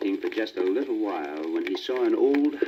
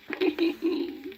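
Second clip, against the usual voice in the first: very high breathy little laugh; a quick airy chuckle; whispered rooster laugh. A narrator's reading. Rooster laughing at his own fate, airy and high.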